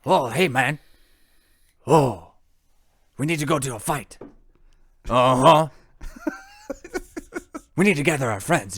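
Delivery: goofy anime character voice; anime voice acting. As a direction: ham up a cartoon anime hero. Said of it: "you were full on, like, voice acting right there".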